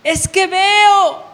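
loud, high shout, stretched out slowly; retold shouting. Old man shouting that his sight is back, loud imitated cry. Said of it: "Pero empieza a gritar el, la, el señor".

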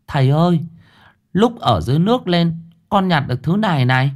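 put-on a young boy showing what he found; boy's voice. A narrator's reading. Boy shows the chain. (giọng cậu bé cao, háo hức)

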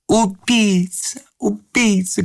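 high, drawn-out cries; screaming. A narrator's reading. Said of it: "кричала дама".

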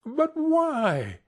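high-pitched, drawn-out appeal; dismayed cry. A narrator's reading. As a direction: cry it out, voice leaping up.

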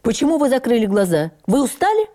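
a touch of a sudden call across the table, rising high; mild loud question. A narrator's reading. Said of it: "его громкий голос".

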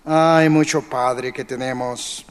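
ordinary delivery

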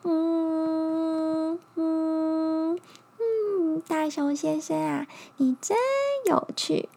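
high, sweet little bug. Acted. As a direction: coax like a cuddly little insect.

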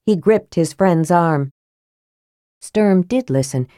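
plain reading voice

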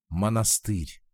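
usual speaking voice

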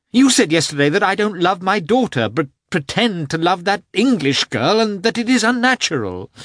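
rising into a high, loud accusation; building from hurt to high accusing outburst; bitter reproach. A narrator's reading. Anna's rising reproach in quarrel.

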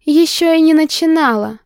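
loud, high furious shout; angry yell. Acted. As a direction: yell it, struggling free. Heroine yelling in his arms.

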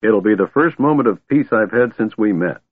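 ordinary delivery, nothing marked

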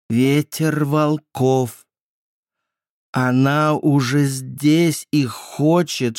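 high, slow, distant voice; eerie childlike voice. A narrator's reading. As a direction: speak slow and strange like a haunted child.

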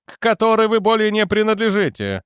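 high, curt correction; sharp interruption. A narrator's reading. Jannek cutting Edwards off about his former service, sharply. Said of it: "резко перебил Дженнек".

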